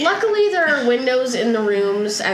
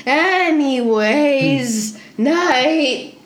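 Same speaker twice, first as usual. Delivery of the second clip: higher, drawn-out innkeeper voice; obnoxious voice; 5 semitones higher, 4 dB louder than usual. Acted. Innkeeper bids guests good night, obnoxious voice. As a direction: ham up an annoying host, pitched up. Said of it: "giving this guy an obnoxious voice".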